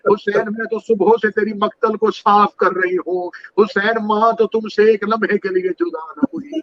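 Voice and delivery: high, strained keening at full force; wailing lament. Acted. Preacher voicing Husain and his mother at Karbala, wailing high. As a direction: cry it out at the top of the voice.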